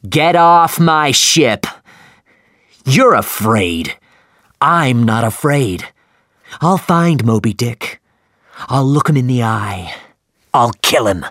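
loud, slow, commanding rage; captain furious at a frightened guest, shouting. Acted. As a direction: order him off the ship in fury. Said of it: "and shouted at him".

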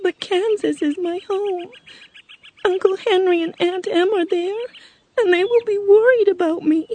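put-on high, crying little-girl voice; tearful. A narrator's reading. Homesick Dorothy begs the witch for help, tearful. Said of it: "The girl went on crying".

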